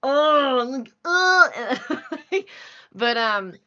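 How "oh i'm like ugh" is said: drawn-out groaning self-disgust; self-critical cringe. Retold or imitated. Voice actress hearing her own recording, cringing. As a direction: drag it out, rising into a comic groan.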